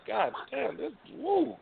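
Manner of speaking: high, breathy wow at the sight; lightly acted wow, impressed; impressed exclaim. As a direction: gasp it out like you just saw something amazing. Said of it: "I'm like".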